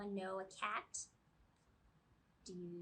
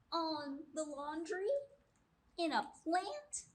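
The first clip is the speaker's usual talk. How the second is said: ordinary delivery, nothing marked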